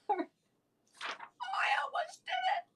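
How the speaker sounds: high squeal of near-slip; surprised yelp. Real, not acted. Nearly saying the word, surprised high squeal. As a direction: squeal as if caught. Almost says the word again.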